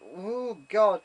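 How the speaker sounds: high ooh, then loud God; mock alarm. Tipsy passenger wobbling down stairs, mock-alarmed.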